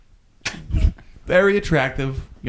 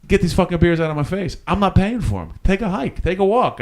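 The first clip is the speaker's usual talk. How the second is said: higher, mildly heated brush-off; retold indignant telling-off; 7 semitones higher than usual. Man refuses to pay tourist at bar, retold indignation. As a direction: re-enact an indignant refusal, pitch raised.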